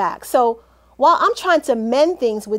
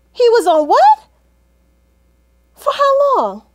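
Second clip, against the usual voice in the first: shocked disbelief; 9 semitones higher, 7 dB louder than usual. Acted. Mother reveals affair on phone.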